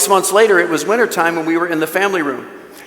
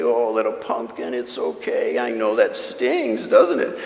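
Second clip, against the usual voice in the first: put-on lower, softer, partly breathless comfort to a hurt child; soothing a toddler; 7 semitones lower, 6 dB quieter, slower than usual. Father comforting his bleeding toddler, soft and lower. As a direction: hush her gently, slow on the stinging line. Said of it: "And we'd say".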